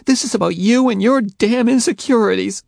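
loud, high furious outburst; Faye, furious; angry. A narrator's reading. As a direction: lash out at him.

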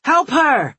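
loud, high urgent shouting; shouts. A narrator's reading. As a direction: yell out over a scuffle. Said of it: "I hear shouts".

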